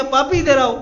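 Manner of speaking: high, loud call. Acted. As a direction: call him over loudly. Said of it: "पुजारी जी ने पूछ लिया".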